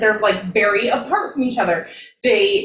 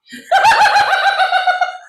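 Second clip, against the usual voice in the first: loud, high cackling laugh; 5 dB louder than usual. Real, not acted. Co-host reacts to a bawdy quote, loud high laugh.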